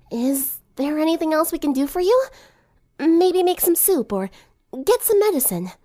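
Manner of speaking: high, hesitant offer of help; shy helpful friend. Acted.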